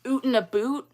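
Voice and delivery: mock "out and about" as "Oot and a boot"; exaggerated Canadian vowels. Shown as a demonstration. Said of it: "Canadian accents are exaggerated on T V".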